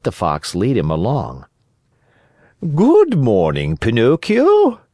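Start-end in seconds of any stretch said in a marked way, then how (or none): 2.7-4.8 high, slow, drawn-out courtesy; sly fox being elaborately polite; courteous fox greeting. A narrator's reading. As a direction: greet them with oily politeness, swooping up on the name. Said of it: "greeting him courteously".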